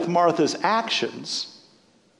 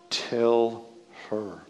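lightly voiced hostess, fading to nothing; Martha's voice again; 10 semitones lower, 7 dB quieter than usual. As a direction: lower and softer, trailing away. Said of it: "Can't you hear it?".

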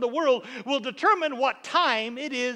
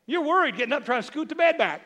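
ordinary talk

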